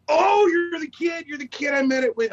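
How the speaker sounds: light up on recognizing someone; re-enacted excited realization; surprised recognition. Director suddenly recognizes the kid.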